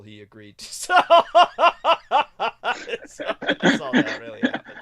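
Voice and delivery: loud, breathy laughing speech. Acted. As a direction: laugh the words out. Laughing through the punchline.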